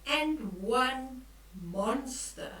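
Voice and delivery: ordinary delivery, nothing marked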